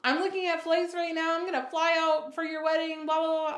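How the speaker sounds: high, loud mock-frantic mother; worked-up mom, pitched high; mom freaking out. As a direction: imitate a mother in a loud flap. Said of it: "my mom starts freaking out".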